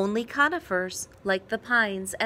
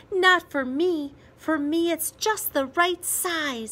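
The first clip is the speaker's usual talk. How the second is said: put-on eager little boy; 6 semitones higher, 4 dB louder than usual. A narrator's reading. Child Peter answering his father.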